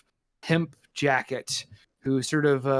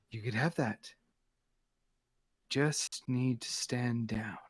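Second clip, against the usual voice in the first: softer, half-whispered warning; quiet threat; 5 semitones lower, 9 dB quieter than usual. Acted. Scarlet Scorpion telling Lucky to stand down, in a hushed warning. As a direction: breathe the offer close and low.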